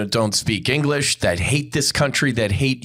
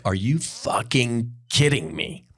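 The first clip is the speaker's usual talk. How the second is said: retold disbelief. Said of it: "I'm like".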